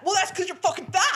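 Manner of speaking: loud, high mock of James snapping. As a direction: bark it back like the rude cast member. Gossip, mocking James's retort.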